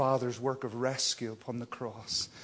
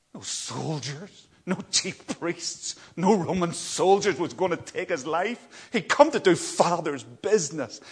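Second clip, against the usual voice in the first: full-force preaching; 6 semitones higher, 10 dB louder than usual. Acted. Preacher proclaiming Jesus's power over death with full force. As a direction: hammer each phrase home to the congregation.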